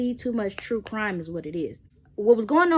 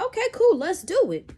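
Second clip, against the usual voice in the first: mock sweet agreement; 10 semitones higher, 3 dB louder than usual. Testing him, fake agreement.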